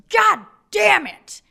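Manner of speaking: loud, high, slow acted yell; furious outburst. Wall Street guy storming in on his family, furious yell. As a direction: explode on each word, drawn out. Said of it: "storms into his home at night".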